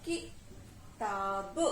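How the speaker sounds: किताब low and very slow, "ki... taaa... buh"; syllable by syllable. Shown as a demonstration. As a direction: split किताब into drawn-out pieces. Teacher repeats split word.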